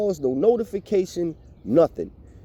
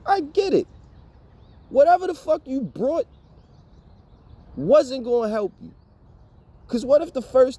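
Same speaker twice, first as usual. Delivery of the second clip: mocking; 8 semitones higher than usual. Real, not acted. Mocking the old landlord.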